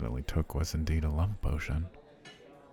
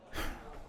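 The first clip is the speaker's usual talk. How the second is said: heavy sigh; 9 dB quieter than usual. Acted. Resigned after the steak complaint.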